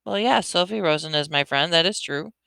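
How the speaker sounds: ordinary delivery, nothing marked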